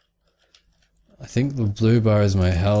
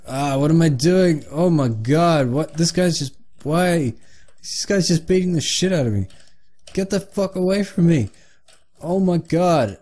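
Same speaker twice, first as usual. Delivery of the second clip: higher-pitched, flustered protest; real gamer panic, pitch climbing; panicked complaining; 6 semitones higher than usual. Real, not acted. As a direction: cry out as you get beaten up. Losing a fight in the game.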